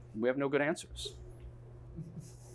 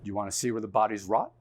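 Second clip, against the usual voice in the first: lower, fast, menacing question; creepy low threat; 7 semitones lower, faster than usual. Retold or imitated. Cop threatening the crew, low and menacing.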